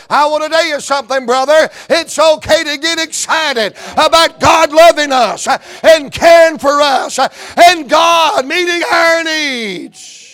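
loud, high preacher's cry; rally the church with full force; fiery preaching. Acted.

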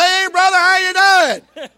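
loud call. Shown as a demonstration. Preacher shows a needless loud greeting to a close brother. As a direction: holler it as if he's far off. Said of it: "I ain't gotta say".

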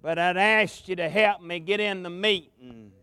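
normal talking voice

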